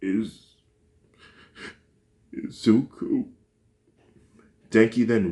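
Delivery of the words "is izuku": voice cracking. A narrator's reading. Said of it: "but his voice cracked".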